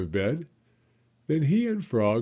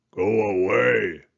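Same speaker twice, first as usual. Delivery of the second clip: grumpy toad; 6 dB louder, slower than usual. Acted. Grumpy toad under the covers.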